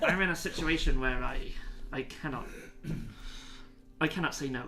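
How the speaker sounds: lightly voiced pirate, Scottish gone West Country; a cornered pirate who can't say no; a hint of a Cornish pirate pleading. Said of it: "I am a West Country pirate".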